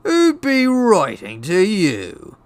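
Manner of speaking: slow, high, swooping sneer; loud sneer. A narrator's reading. Uncle mocking nephew over his letter, drawn-out sneer. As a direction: drag it out with loud scorn. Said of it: "sneered Uncle Vernon".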